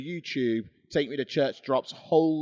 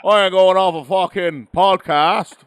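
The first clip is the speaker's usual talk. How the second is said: Mick Foley; 5 semitones higher, 13 dB louder than usual. Pretend. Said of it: "What's the worst you're gonna say?".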